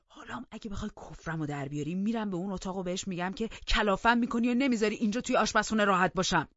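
fast, building to a loud finish; heated rapid-fire warning; angry threat. A narrator's reading. Threatens to report her, quarrelling.

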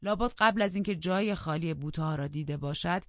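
ordinary delivery, nothing marked

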